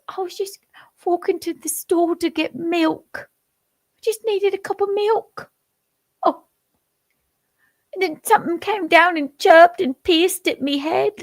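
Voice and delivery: squeaky English pensioner whining about his milk; English accent. Retold or imitated. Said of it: "I can't do an English accent".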